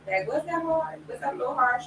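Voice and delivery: mildly puzzled, lifted pitch; self-questioning. Retold or imitated. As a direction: lightly voice a self-check. Voices questioning herself.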